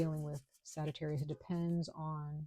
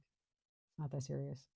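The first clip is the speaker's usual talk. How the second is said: slow and softer, brushing it off; quiet, slow shrug of a line; soft, slow dismissal; 2 semitones lower, 6 dB quieter, slower than usual. Shown as a demonstration. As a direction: say it slow and hushed, no big deal. Showing others to relax.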